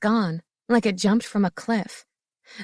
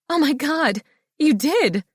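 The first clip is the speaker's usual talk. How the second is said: overjoyed; 7 semitones higher, 3 dB louder than usual. A narrator's reading. Overjoyed at proposal.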